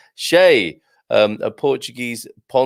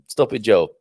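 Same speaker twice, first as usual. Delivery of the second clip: ordinary talk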